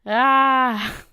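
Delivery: high, loud panicked scream; screaming in shock at the sword. Acted. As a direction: let out a big terrified yell. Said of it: "I didn't know I was capable of that kind of volume".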